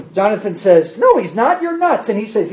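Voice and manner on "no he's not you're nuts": a touch of a dismissive friend. Retold or imitated. Jonathan voiced in Bible retelling.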